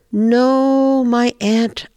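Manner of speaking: high, drawn-out owl voice; refusing grandmother owl. Acted. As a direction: draw out a high-pitched gentle refusal. Grandmother Owl turns down Bear.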